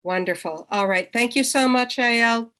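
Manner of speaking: ordinary talk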